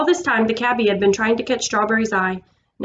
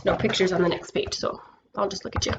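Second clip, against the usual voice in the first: whispered aside; 10 dB quieter than usual. Real, not acted. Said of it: "aside to the child listening".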